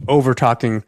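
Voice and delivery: normal talking voice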